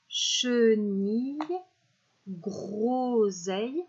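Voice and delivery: words drawn out slowly with a rise; slow dictation. Shown as a demonstration. Teacher reviewing the words with children, slow. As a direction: stretch each word slowly, rising.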